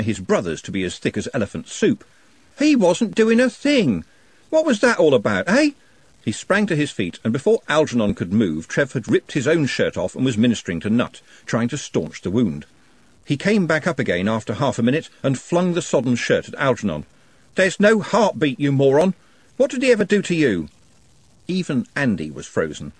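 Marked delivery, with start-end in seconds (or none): 2.6-5.7 high, loud furious yell. A narrator's reading. Trev yelling at Algernon for the blow, furious. 17.6-20.7 furious grief. A narrator's reading. Trev yelling at Algernon over dead Nutt, furious.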